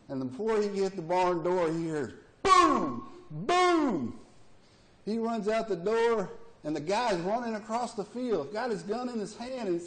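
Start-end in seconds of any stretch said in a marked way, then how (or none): 2.4-4.2 loud high mock gunshots; gunshot imitation. Preacher imitating the gunshots from the barn, loud. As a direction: blurt the booms like shots.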